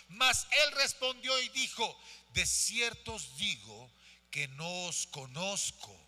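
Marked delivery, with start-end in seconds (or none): 2.3-6.0 low, slow, grave refusal; stern answer from scripture; solemn bridegroom. Acted. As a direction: pronounce it slowly, lower and softer.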